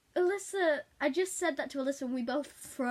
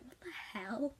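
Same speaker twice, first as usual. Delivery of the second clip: breathy unvoiced whisper of disbelief; shocked whisper; 7 dB quieter, slower than usual. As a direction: whisper it like a horrified onlooker. Chat viewers' reaction retold.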